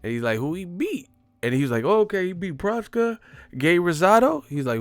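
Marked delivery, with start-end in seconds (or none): 0.4-1.1 high-pitched dismissive question; higher, doubting impression voice; Roger Mayweather. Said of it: "Roger Mayweather voice". 1.8-4.4 high-pitched eager interviewer impression; much higher, louder interviewer voice; Ellie Seckbach. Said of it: "I remember his voice".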